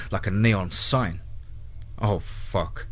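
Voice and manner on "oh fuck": ordinary delivery, nothing marked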